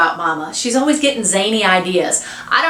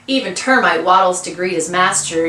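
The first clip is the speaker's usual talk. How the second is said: plain reading voice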